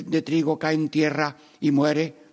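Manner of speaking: ordinary talk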